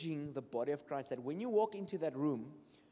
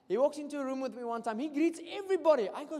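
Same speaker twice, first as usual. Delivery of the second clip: ordinary delivery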